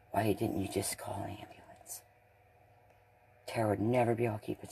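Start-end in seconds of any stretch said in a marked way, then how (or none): 0.1-2.0 low, hushed accusing whisper. Real, not acted. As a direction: breathe the question out with no voice. Accusing question in retold scene.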